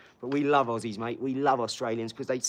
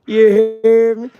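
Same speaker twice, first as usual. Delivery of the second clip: drawn-out, high, very loud holler; hyped shout; 9 semitones higher, 17 dB louder, much slower than usual. Pretend. Streamer hyping up the stream, hollered catchphrase. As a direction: stretch it out and belt it high.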